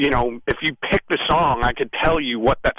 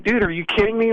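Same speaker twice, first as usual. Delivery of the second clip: higher-pitched, mildly exasperated disbelief; incredulous protest; 8 semitones higher, faster than usual. Retold or imitated. Songwriter arguing with co-writer over a title, incredulous protest. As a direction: protest as if it's absurd.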